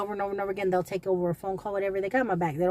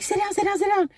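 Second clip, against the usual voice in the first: much higher, urgent "sit down"; urgent coworkers; 11 semitones higher, 5 dB louder than usual. Retold or imitated. Coworkers urging fainting Melissa to sit, high and urgent.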